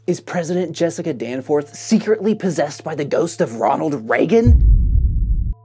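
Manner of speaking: breathless mock conspiracy, slowing down; mock dramatic gasp. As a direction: gasp and drag the question out.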